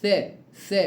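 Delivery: ث held alone, tongue tip between the teeth; teacher isolating ث; isolated tha. Shown as a demonstration. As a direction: sound out the bare ث twice. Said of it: "والثاء حرف لثوي".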